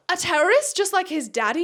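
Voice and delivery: high, rising teasing question; mock-scandalised question. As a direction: play the shocked audience, pitch swooping up. Voices the audience's scandalised question.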